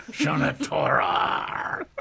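loud hammy pirate growl. Pretend. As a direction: shout it like a pirate. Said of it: "since we are at the end of Talk Like a Pirate Day".

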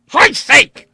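very high, very loud cry; angry outburst. Acted. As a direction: burst out in fury. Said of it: "Vandeleur burst out".

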